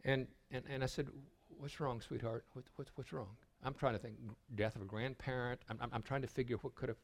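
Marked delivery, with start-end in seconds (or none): 1.6-3.4 much softer, tender asking; softly soothing a crying second grader; gentle comfort. Retold or imitated. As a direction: hush it like soothing a crying child. Comforting a sobbing little girl.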